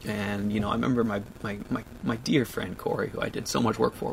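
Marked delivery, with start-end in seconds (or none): none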